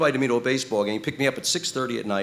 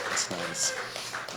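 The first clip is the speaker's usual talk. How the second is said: breathy hushed repeat; an unvoiced murmured echo of the first take; whispered aside; 4 semitones lower, 7 dB quieter than usual. Shown as a demonstration. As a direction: whisper it to yourself.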